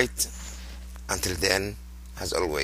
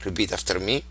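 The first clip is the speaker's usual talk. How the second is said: ordinary talk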